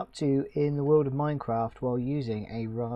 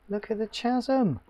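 excited; 11 semitones higher, 4 dB louder than usual. Real, not acted. Spots a chasm in game.

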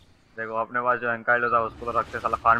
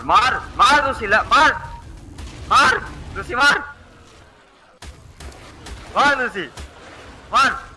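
urging shout; 8 semitones higher than usual. Real, not acted. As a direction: egg the beast on to attack. Urging his pet Lucy to attack. (ऊँची आवाज़ में पालतू को हमला करने के लिए उकसाना)

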